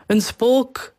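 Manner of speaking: high-pitched, understated cry of fright; mild panicked cry. A narrator's reading. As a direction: call it out in restrained alarm. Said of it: "riepen ze".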